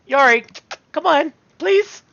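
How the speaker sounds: high, loud, drawn-out pleading whine; loud slow exasperated plea; pleading exasperation. Acted. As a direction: beg him to get up, slowly. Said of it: "and I'm just like".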